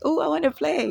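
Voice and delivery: put-on mildly playful child voice; childlike. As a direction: ask to play like a small kid. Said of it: "like a child".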